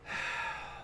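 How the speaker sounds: long, soft exhale; exasperated orc sigh. Acted. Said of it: "looks at him, and goes".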